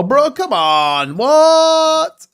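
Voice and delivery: drawn-out protest leaping to a high "what"; exasperated disbelief. Real, not acted. Streamer finds his indicators deleted, exasperated disbelief. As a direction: groan the complaint, then shoot up in disbelief.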